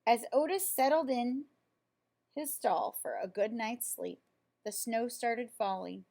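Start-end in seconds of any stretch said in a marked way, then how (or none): none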